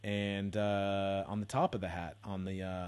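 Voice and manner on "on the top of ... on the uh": ordinary talk, nothing marked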